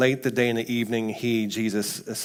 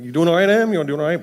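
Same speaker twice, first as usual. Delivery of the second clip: higher, louder checking-in; concerned parent; 6 semitones higher, 8 dB louder, faster than usual. Retold or imitated. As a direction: fuss over them like a worried parent. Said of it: "being like a concerned parent".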